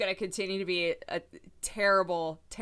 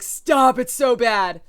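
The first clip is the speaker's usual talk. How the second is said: retold protest; 4 semitones higher, 11 dB louder, slower than usual. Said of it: "everybody's like".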